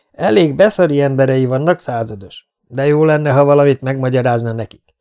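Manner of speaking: loud, scornful retort to the captain; shouted warning. Acted. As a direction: project a firm threat out into the street. Besieged man shouts back a warning. (hangosan kikiabált figyelmeztetés)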